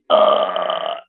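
long retold groan of defeat; drawn-out "ugh" of frustration; disappointed groan. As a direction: groan out the letdown. Reaction to not getting the job.

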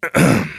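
a quick real throat clear. Real, not acted. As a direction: clear the throat before starting over. Clears throat before retrying.

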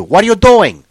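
loud, high shopkeeper yell; imitated screaming counterman; mock scream. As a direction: yell it at a suspected loiterer. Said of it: "they scream at you".

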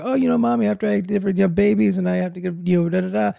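put-on high little-girl voice; imitating the daughter. Mimicking her young daughter to his wife, high-pitched. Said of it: "you were hearing her say".

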